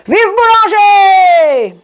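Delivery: long high shout, falling at the end; frustrated cry. A narrator's reading. Man whose friends never recognise him, frustrated drawn-out shout. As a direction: holler it very slowly and high. Malcontent repeats his rallying cry.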